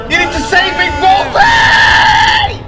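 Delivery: shrieking plea, very high and loud; panicked scream. Real, not acted. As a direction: scream for your teammate to save you.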